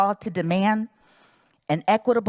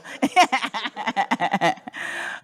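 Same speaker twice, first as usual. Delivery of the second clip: real chuckle at one's own remark; amused laugh. Real, not acted. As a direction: laugh it off in a quick run of chuckles. Chuckles after saying point was taken.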